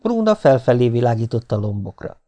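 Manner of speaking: usual speaking voice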